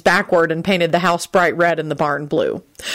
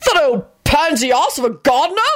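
high-pitched outraged sputter; indignant bellow; 9 semitones higher than usual. A narrator's reading. Husband scorning the gardener rival, outraged and high. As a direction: splutter with high, scandalised indignation. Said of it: "Richard bellowed, his face red".

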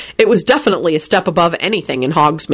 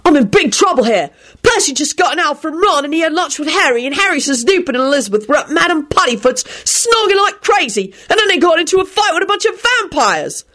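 high, rushed panicked voice; panicked rant; 10 semitones higher than usual. A narrator's reading. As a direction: gush the bad news in a panic. William panicking on the phone.

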